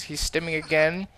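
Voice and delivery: usual speaking voice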